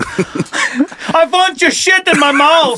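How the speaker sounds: put-on very slow, high-pitched German accent; German soldier. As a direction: draw it out in a hammed-up German accent. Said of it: "the voice of a German sh-, soldier".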